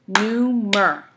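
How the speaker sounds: "Neumer" as NEU, MER in two strong beats; both syllables of "Neumer" sounded out separately; syllables split apart. Shown as a demonstration. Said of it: "That's two syllables in neumer".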